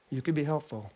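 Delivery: ordinary talk, nothing marked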